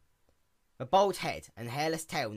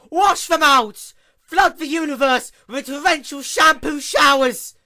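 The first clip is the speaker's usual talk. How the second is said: high, loud commanding yell; 17 dB louder than usual. Acted. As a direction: roar the command, slow and wild. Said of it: "Luna yelled amidst a dust cloud".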